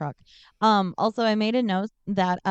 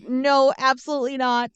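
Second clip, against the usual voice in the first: louder, higher emphatic refusal; mock-adamant and raised; retold firm refusal; 5 semitones higher, 5 dB louder than usual. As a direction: dig your heels in, acting it out. Acted second refusal to look up.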